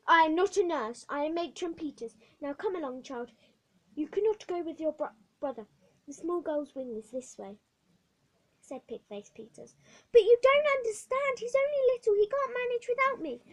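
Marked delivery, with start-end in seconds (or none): 0.1-7.6 loud, clipped institutional command; strict matron ordering a girl; stern matron. Acted. Matron Peters orders the child. 10.1-13.4 put-on much higher, loud, frantic child's protest; girl pleading with the matron; desperate girl. Hetty protests for her brother.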